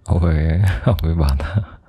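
usual speaking voice

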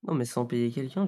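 usual speaking voice

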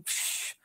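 breathy "psh" noise; dismissive puff. Retold or imitated. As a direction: blow out a quick, quiet psh. Imitated scoff in retold answer.

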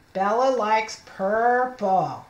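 slow reading. Shown as a demonstration. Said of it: "I put my finger under".

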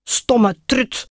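cross call. A narrator's reading. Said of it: "riep hij".

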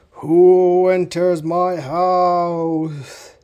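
slow, booming ghost voice; loud, drawn-out ghostly demand; unearthly voice. Acted. Ghost voice threatens visitors.

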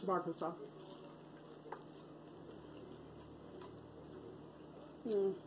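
licking fingers while talking; just finished eating; tags: with food in mouth. Real, not acted. Seen 0: eats chicken before, licks fingers at 0–1s, then shows empty plate.

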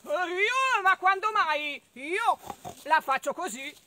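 loud grunting pig voice. Acted. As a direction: snort out the denial. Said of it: "non era stato neanche il maiale".

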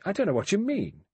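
high, sharp indignant denial; angry. A narrator's reading. Doctor accused of overdose, answers Blore angrily. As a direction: snap back, offended. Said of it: "Doctor Armstrong said angrily".

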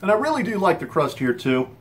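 speaking between bites; talking while chewing. Real, not acted. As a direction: mouth partly full. Seen 2: Bit crust at -5; jaw still working while speaking, crust in hand.

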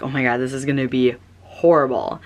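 ordinary delivery

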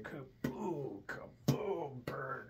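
mock fart noises. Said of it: "He's sitting there just like".

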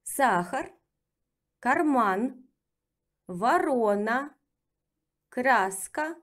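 ворона drawn out, each vowel full; slow listing rise on each word; careful model reading; slow drill reading. Shown as a demonstration. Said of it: "let's practice with reading".

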